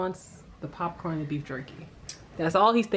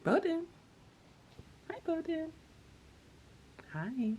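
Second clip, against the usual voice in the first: high, soft voice for a dog; sweet dog voice; 11 semitones higher, 10 dB quieter, slower than usual. Real, not acted. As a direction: coo at the dog gently. Greeting the dog.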